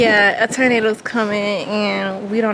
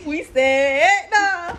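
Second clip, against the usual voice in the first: slow, higher, drawn-out refusal; drawn-out replay; 6 semitones higher, slower than usual. Retold or imitated. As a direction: stretch it out slowly in a higher voice. Mimicking own refusal, drawn out.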